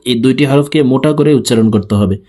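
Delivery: ordinary talk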